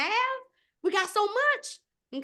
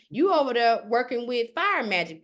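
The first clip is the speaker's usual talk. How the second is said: ordinary talk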